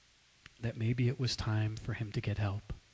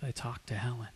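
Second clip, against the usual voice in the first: hushed whisper; 2 semitones lower, 7 dB quieter, faster than usual. Retold or imitated. Friend confronting friend quietly in a coffee shop, whispering. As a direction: lean in and breathe the words, no voice.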